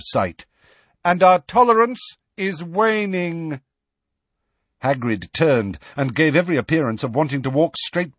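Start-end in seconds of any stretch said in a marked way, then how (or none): 1.0-3.6 high, carrying centaur warning; calling after. A narrator's reading. Magorian warning Hagrid from afar, calling out. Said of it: "Megorian called after them".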